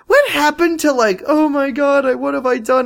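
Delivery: shrill, overwrought mock panic; high mock distress. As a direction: overact the guilty character. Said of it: "I was like".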